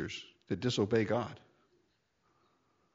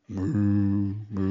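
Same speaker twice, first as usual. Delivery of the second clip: mock mooing; 5 semitones lower, 3 dB louder than usual. Cows lowing on the road.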